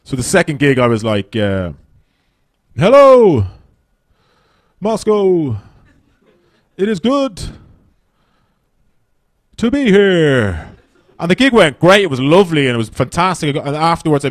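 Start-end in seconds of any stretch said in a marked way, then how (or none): 2.8-10.7 higher, louder, very slow hello; comic over-slow greeting to the Moscow audience. Shown as a demonstration. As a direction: spell it out for a foreign crowd. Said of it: "the guy told me to slow down".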